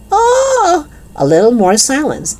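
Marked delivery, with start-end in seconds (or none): 0.1-0.9 high-pitched drawn-out wail. A narrator's reading. Heartbroken teacher outside the classroom, another high wail. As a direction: let out a high cry of despair. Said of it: "Another wail".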